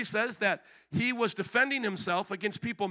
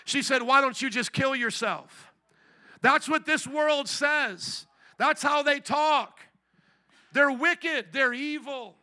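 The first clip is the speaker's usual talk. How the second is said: raised, impassioned preacher voice; forceful preaching; 2 semitones higher, 3 dB louder than usual. Real, not acted. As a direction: drive it home to the congregation.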